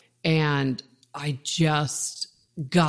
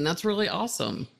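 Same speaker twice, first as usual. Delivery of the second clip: usual speaking voice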